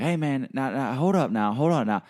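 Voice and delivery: high, insistent stranger voice, speeding up at the end; imitated street hustler. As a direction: mimic a pushy guy stopping a passerby. Imitates stranger hailing him on street.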